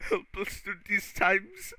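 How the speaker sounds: high, slow pleading prayer voice; mock prayer. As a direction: pray it in a high, slow plea.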